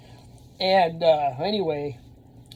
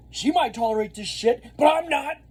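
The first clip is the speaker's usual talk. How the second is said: high, rising angry scolding; telling off a rude kid; retold yell; 9 semitones higher, 3 dB louder than usual. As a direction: build the threat to the end. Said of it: "I start yelling at this kid".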